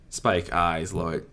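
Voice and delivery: plain reading voice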